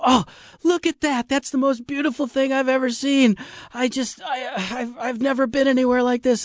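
high, breathless gushing wonder; awed old rafter. Retold or imitated. Old man marveling at the river canyon, high and awed. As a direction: gush like an old man seeing beauty. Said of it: "and he'd go".

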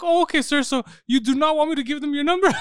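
high-pitched, over-polite mock agent; polite call-centre agent. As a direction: imitate a placating phone agent. Acts polite call agent replying.

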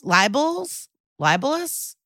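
"libels? Libelous?" high, questioning rise at the end; unsure of the word. Real, not acted. Podcaster stumbling on an odd word, unsure and questioning. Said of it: "That doesn't sound like a real word".

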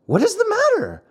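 high, startled asking; alarmed question. Acted. Pinocchio startled by his friend's cry, alarmed. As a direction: ask in sudden alarm.

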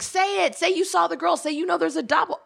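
high, insistent mock plea to a TV character; urging the screen. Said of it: "I'm like".

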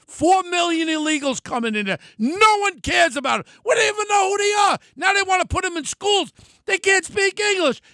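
angry rant. Real, not acted. Podcast host railing at listeners about the border, angry shout. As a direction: let the outrage boil over, voice climbing.

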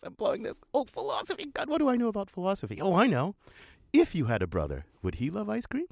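high, frantic thinking aloud; flustered son. Retold or imitated. Flustered son talking himself through his failing date. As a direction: scramble for an idea, voice jumping up. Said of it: "He says".

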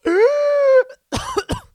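loud, high, strangled choking cough; imitated choking cough. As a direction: act out choking on cheese. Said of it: "and I just go".

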